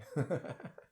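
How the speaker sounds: light, quiet chuckle. Real, not acted. Conductor after asking for no breathing, light chuckle. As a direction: laugh briefly to yourself.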